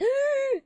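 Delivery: high sudden gasp; loud surprised intake; sharp gasp. Real, not acted. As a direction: catch your breath in surprise. Dramatic gasp.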